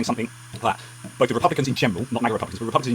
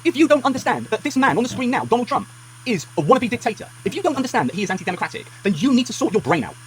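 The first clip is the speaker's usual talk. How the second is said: angry; 11 semitones higher than usual. Real, not acted. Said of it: "I am genuinely angry about that".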